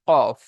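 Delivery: কফ said short and loud, deep from the back of the tongue; qaf demo. Shown as a demonstration. Teacher demonstrating qaf's articulation point, loud crisp demo. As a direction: bark out কফ crisply from the throat's back. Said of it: "চার নম্বর মাখরাজ কি?".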